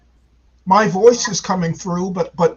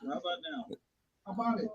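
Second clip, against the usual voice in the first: faint delayed echo through a speaker; distant repeat from another device; echo from second device; 18 dB quieter, much faster than usual. Real, not acted. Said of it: "There's still an echo".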